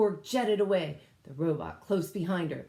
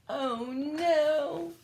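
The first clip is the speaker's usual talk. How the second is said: ordinary delivery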